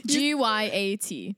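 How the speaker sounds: spelled out slowly, each letter crisp; over enunciating. Shown as a demonstration. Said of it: "Can you say it one more time?".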